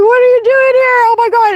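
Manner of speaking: high-pitched, loud startled outburst; mock freak-out. Woman startled by Jesus beside her, freaking out. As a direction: re-enact freaking out at an unexpected visitor. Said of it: "freaking out".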